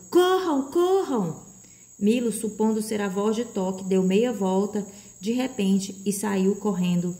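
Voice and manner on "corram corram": high-pitched urgent cry; urgent shout. A narrator's reading. A mysterious voice urges them to flee, shouting. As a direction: call out high to make them run. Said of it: "uma voz gritou".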